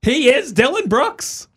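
high, loud disbelief; can you believe this guy; incredulous. Real, not acted. Incredulous mocking rant.

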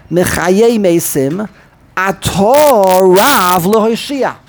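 slow, "Atah" stretched a-TAAH, running into "Rav"; no stop. Shown as a demonstration. Teacher reading it connected, end-stressed "Atah". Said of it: "then you don't stop".

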